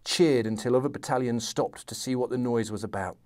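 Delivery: reading a novel aloud, steady and measured; story narration. A narrator's reading. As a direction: tell the battle scene like a storyteller. Continues reading the Sharpe excerpt.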